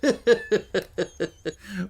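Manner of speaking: hearty booming laugh; heavy laugh. Acted. Wilson laughing off Holmes's explanations, heavy hearty laugh. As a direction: let out a big heavy laugh. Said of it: "Mister Jabez Wilson laughed heavily".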